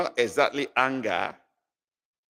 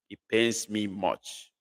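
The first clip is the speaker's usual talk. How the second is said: slow clear translation; 2 semitones lower, 4 dB quieter than usual. Shown as a demonstration. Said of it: "The loss of that thing pains me much".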